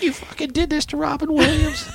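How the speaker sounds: high, scolding accusation; mock outraged mom. Mom on the phone blaming her son, high outraged voice. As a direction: shriek it like a shocked mother.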